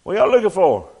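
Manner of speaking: loud, high call across a distance. Acted. Stranger calling out loudly.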